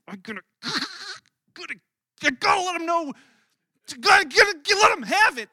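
shouting, worked up and high; hammed-up rage at a post; loud mock fury, voice jumping high; mock angry outburst. Acting out online rage urge.

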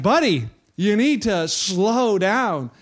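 half-speed, drawn-out counsel; deliberately slow and emphatic; slow, pointed advice. Retold or imitated. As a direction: stretch it out to calm a hasty friend. Retold advice to frantic angler.

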